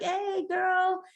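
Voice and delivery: put-on high chirpy girlfriend greeting; mock sweet hello to a friend; sweet hey girl. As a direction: greet her way up high and sugary. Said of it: "Kiara was like".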